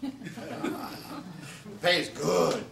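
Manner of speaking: drawn-out laughing; laughing, slow. Shown as a demonstration. Comic telling of the gig's pay, slow and laughing. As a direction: stretch it out through a laugh. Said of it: "by good, I mean three hundred and fifty dollars".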